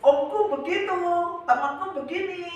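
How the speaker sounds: high, loud whiny complaint; mock complaining listener. Preacher mimics a hurt believer, high and whiny. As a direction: whine like a wounded churchgoer.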